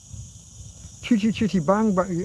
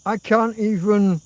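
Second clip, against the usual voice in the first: ordinary delivery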